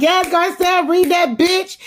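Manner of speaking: high, gleeful cheer for Garcelle; cheering her on. Retold or imitated. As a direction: egg her on like a fan at the TV.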